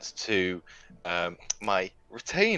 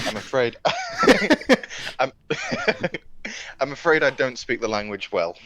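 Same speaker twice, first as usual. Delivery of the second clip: laughing through the line; stifling laughter while speaking; 5 semitones higher, 4 dB louder than usual. Acted. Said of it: "catch myself and say".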